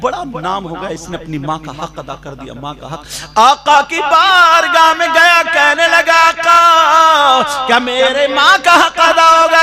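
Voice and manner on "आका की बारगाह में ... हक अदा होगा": loud, slow, swelling sermon voice; impassioned pulpit delivery; full-force preaching. Acted. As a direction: build to the Prophet's verdict. Preacher recounts the Prophet's answer.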